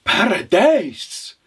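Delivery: high, drawn-out amazed exclamation; startled. Acted. Widow mishearing the traveller, startled. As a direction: stretch the word in astonishment. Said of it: "startled at the man".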